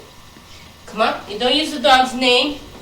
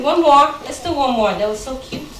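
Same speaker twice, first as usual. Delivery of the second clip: usual speaking voice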